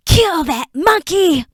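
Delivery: high, loud, slow shriek; frantic shriek. Acted. Creature shrieking as Enoch escapes. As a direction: scream the order in panic.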